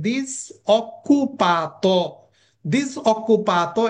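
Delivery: ডিসঅকুপাতো drawn out syllable by syllable, the double k held; teacher slowly modelling ডিসঅকুপাতো; slow pronunciation drill. Shown as a demonstration. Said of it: "শব্দটা একটু সবাই ভালো করে মনোযোগ দিয়ে শুনেন".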